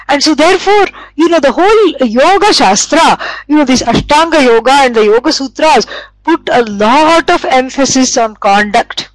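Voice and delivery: normal talking voice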